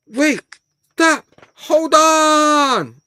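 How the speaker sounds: high, loud, very slowly stretched; exasperated interruption. Acted. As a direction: drag it out in protest. Interrupting the story, drawn out.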